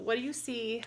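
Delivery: plain reading voice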